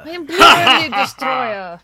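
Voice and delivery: loud, booming put-on Vurin voice. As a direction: bellow it as the Vurin. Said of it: "the Vurin voice".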